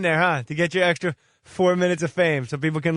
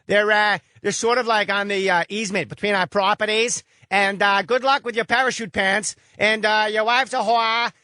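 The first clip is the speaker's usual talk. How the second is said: put-on Boston accent; 4 semitones higher than usual. Host mocking the caller as a rude Boston neighbor in a thick Boston accent. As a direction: ham up a rude Southie townie neighbor. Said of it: "why do you sound like you're from Dorchester".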